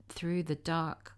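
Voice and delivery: ordinary delivery, nothing marked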